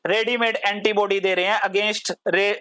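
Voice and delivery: ordinary delivery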